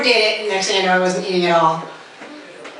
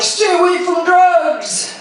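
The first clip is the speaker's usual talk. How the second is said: higher, slow, mock-stern warning; 6 semitones higher, slower than usual. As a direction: lecture the kids slowly like a doctor. Doctors warning the young.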